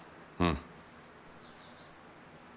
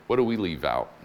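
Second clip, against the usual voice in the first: usual speaking voice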